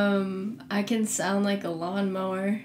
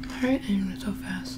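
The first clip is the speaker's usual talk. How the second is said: whispering. Real, not acted. Whispering while eating together.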